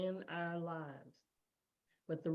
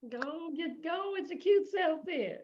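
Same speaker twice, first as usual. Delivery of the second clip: playful cheer; 11 semitones higher, 6 dB louder than usual. Real, not acted. Host cheers her on.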